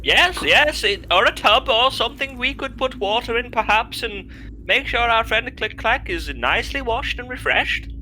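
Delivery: posh character voice. Acted.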